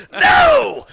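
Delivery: shrieked high and loud; a comic yelp of dismay; hammed-up horrified shout; mock horrified no. Mortified at being caught crying.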